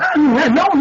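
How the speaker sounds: sarcastic high yelp of disbelief; mock surprise. Speaker scoffing at the patriotic slogan, mock surprise.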